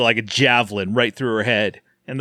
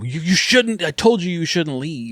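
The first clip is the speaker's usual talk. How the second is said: higher-pitched retold telling-off; mock scolding; 4 semitones higher than usual. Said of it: "Bo's mad at him".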